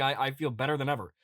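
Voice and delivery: ordinary talk, nothing marked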